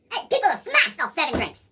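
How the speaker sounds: usual speaking voice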